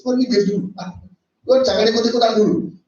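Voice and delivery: an imitated angry outburst; mock rage. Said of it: "Wah, dia ngamuk". (amarah pura-pura, cepat dan keras)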